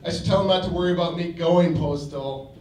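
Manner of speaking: ordinary delivery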